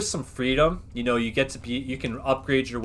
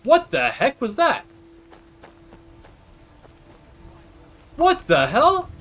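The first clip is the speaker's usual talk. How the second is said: startled; 12 semitones higher, 5 dB louder than usual. Real, not acted. Startled by something in game.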